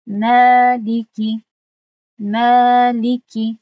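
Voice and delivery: ملیکی with the long vowel held for two counts, recited; correct two-count ملیکی. Shown as a demonstration. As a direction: recite ملیکی with its proper two-beat length. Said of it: "باید تنها با دو حرکت دراز کرده شود".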